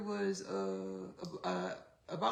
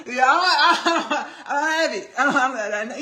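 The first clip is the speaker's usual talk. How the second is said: loud, high, helpless laughing; laughing protest, much louder; cracking up; 6 semitones higher, 16 dB louder than usual. Real, not acted. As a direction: laugh hard through the words while backing out. Cracks up, backing out of accent.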